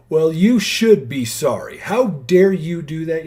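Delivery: loud, slow, indignant rebuke; scolding response. Pretend. Person scolding someone who apologised, loud indignant rebuke. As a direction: tell them off like they owe you. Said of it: "few different responses I can give".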